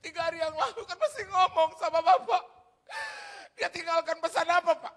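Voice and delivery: very high, very loud mock crying; imitated wailing. Preacher imitating someone bereaved of their father, loud wailing. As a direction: wail the words in hammed-up grief. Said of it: "orang yang nangis gitu, kehilangan bapaknya".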